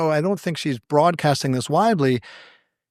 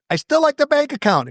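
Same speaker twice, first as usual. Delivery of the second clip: put-on whiny kid; 10 semitones higher, 4 dB louder than usual. Childish insistence on the phone.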